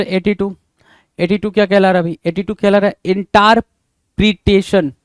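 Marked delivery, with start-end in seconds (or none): 3.1-4.9 इंटरप्रिटेशन with long "taar", drawn out slowly; misspelling read out. Shown as a demonstration. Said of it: "तो इंटर में टार जो लिखा है".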